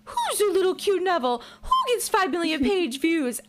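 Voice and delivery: put-on high baby-talk coo at a pet; mock cooing. [name] cooing at Neville like a puppy, mock sweet. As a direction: coo like petting a dog. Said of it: "cooing at him like".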